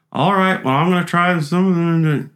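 imitating Kent.

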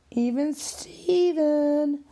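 mock sing-song rhyme; playful sing-song. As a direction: stretch it out like a jingle.

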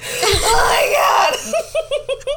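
very high, loud, drawn-out wail; mock tearful wail. Parent acting out breakdown on adoption day, wailing. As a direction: cry it out hugely, hammed-up. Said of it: "I broke down into tears".

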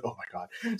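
dismayed hush. Retold or imitated. Realizing disaster.

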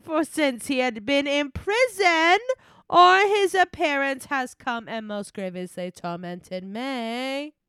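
slow, theatrical diva reading; Moira Rose. Acted. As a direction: stretch the words grandly, with swooping line endings. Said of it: "read that in her voice".

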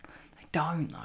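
mock whisper. Mate whispering back in football banter, mock whisper. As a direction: hiss it back like a secret.

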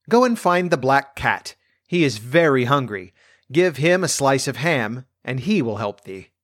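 plain reading voice

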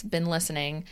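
ordinary talk, nothing marked

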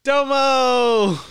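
high, loud cheerful shout of Domo; excited shout. Real, not acted. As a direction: announce it with a big happy cry.

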